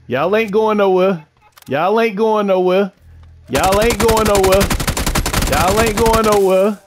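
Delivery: loud, high, slow gloating chant; taunting yell. Real, not acted. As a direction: rub it in, yelling. Taunting the beaten enemy.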